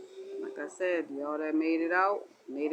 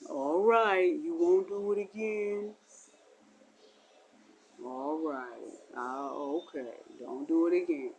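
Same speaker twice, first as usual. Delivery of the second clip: lower, softer voice granting mercy; God's reply; 4 semitones lower, 3 dB quieter than usual. Acted. God answering the begging sinner, lower and soft. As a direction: answer the sinner calmly from above.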